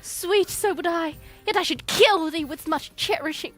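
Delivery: ordinary delivery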